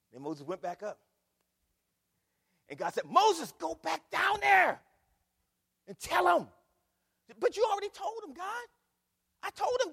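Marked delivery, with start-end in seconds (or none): 3.1-6.5 slow, shouted, much higher order; booming command. Acted. God repeats command, shouting. 7.4-9.9 high, pleading protest, louder; exasperated Moses. Acted.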